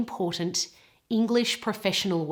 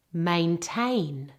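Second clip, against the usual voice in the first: "Maintain" drawn out, weight on TAIN; careful teacher's "Maintain", stressed at the end; stress on second syllable; 3 semitones lower than usual. Shown as a demonstration. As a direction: model "Maintain" with two full A vowels, the second stressed. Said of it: "the emphasis and stress is all on the second".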